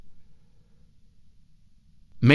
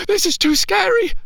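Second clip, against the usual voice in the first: put-on high, frightened child's voice; frightened boy alone in his tent; scared little boy. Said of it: "said the boy to himself".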